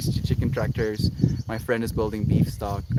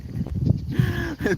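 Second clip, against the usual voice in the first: high giggle. Real, not acted. Laughs before skit.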